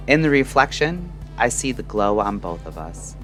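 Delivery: ordinary talk, nothing marked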